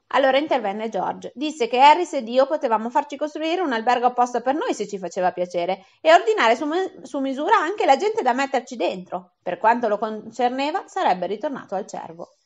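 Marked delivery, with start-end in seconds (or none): none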